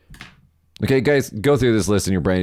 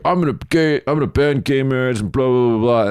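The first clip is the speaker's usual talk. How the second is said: higher-pitched mocking politician rant; 3 semitones higher than usual. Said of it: "they're like".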